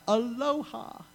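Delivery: lilting high aloha, demonstrating the shout; high mock aloha. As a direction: sing out aloha, high and bright. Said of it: "you shout out".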